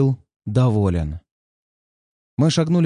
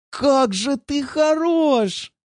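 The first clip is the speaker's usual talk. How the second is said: much higher, slow, thrilled cry; excited exclamation; 12 semitones higher, much slower than usual. Acted. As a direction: gush with delight, drawn out. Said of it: "воскликнула Юля".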